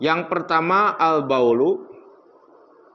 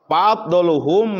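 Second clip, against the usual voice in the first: Arabic recitation; 4 semitones higher, 4 dB louder, slower than usual. Acted. Reciting Arabic text in sermon.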